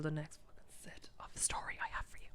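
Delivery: soft ASMR whisper, voice off; whispered teaser, ASMR style. Shown as a demonstration. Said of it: "S M R".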